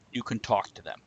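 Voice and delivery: usual speaking voice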